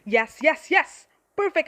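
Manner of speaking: loud rising triumphant yes; loud gleeful cheering. Acted. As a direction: cheer her on with wicked delight. Unicorn cheering the cruel act.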